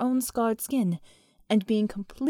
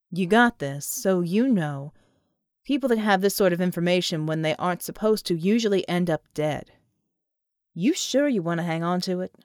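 John asks for whiskey, lower voice; John's voice; 4 semitones lower than usual. Acted.

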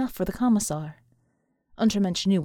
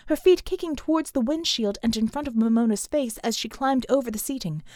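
usual speaking voice

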